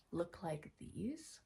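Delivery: whispering. Shown as a demonstration. Whispered question to child.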